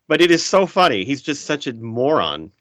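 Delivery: normal talking voice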